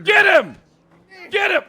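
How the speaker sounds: yelled order, high and loud; shouting. Real, not acted. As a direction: shout the command at full force.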